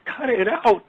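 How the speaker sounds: high sharp mock scolding; mock scold from a stranger in the store; scolding woman. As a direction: imitate her telling him off, pitched high.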